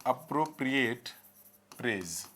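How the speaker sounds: very slow, split by a pause; slow dictation. Shown as a demonstration. Teacher dictates the phrase while writing.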